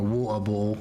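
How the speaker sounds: rough British "water bottle", T's dropped; clumsy mock-British accent; butchered British accent. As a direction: attempt British English, swallowing the T's. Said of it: "if I say it in a British language".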